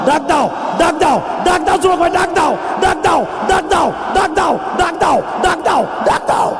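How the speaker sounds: high, loud repeated calls; fervent shout. Real, not acted. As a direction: drive the crowd to cry out to God. Preacher urging crowd to pray.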